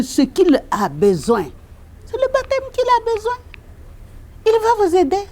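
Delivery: high, earnest plea to the family; fervent pleading. Retold or imitated. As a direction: urge them passionately toward baptism.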